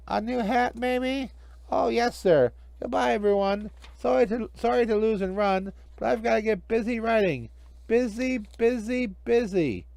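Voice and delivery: high, giddy magician voice; scurry off thrilled; eager magician. Acted. Hinkle eager.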